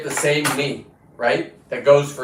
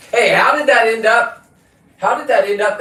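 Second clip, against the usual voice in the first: raised voice calling across the room; 8 semitones higher, 6 dB louder than usual. Real, not acted. Calling out loudly to Bill in the back. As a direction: call out to Bill across the shop.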